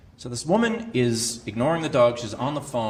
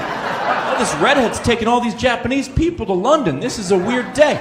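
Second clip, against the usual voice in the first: mock bewildered; 9 semitones higher, 6 dB louder than usual. Imagined passengers' confused thought.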